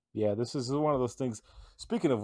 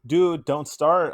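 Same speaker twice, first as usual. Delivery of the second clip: louder, slow exasperated plea; 5 semitones higher, 9 dB louder, slower than usual. Real, not acted. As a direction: beg them not to start. Annoyed at food question.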